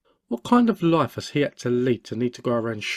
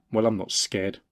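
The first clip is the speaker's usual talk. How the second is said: ordinary talk, nothing marked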